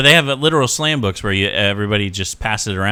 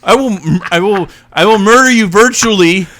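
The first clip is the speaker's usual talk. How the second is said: mock threat yell; 8 semitones higher, 6 dB louder than usual. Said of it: "I was literally yelling at him".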